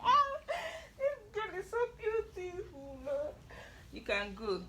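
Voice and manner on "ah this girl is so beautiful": gushing. Real, not acted. Emotional gushing.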